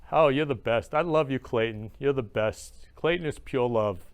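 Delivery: ordinary talk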